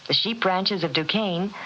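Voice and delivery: ordinary delivery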